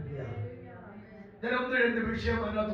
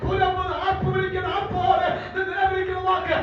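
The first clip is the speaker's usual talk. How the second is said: high, driving revival preacher shout; impassioned pulpit proclamation in a sustained high voice; full-force preaching; 9 semitones higher than usual. Acted. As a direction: declare it to the congregation at full cry.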